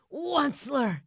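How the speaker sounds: high, loud croak of a name; loud high croaking call; croaking Lorax. A narrator's reading. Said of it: "with a cruffulous croak".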